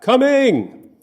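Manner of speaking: high, loud call carried over a pond; hollered reply. Retold or imitated. Father answering his sons from the cabin porch, loud holler. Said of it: "I hollered back".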